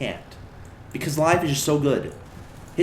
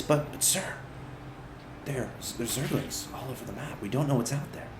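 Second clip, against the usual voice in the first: softer, hesitant pleading with stammers; frightened soldier; 3 semitones lower, 11 dB quieter than usual. Acted. SCV begging the commander not to send him, softly afraid. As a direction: beg him not to send you, in a small voice.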